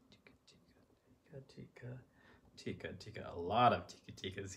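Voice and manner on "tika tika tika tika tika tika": whispered rhythm to herself. Real, not acted. Reading rhythm under her breath.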